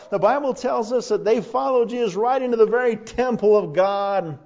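loud, driving sermon delivery; forceful preaching. Real, not acted. Preacher telling the congregation of Jesus entering Jerusalem, loud and driving. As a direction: preach it out with rising energy.

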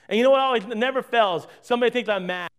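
ordinary delivery

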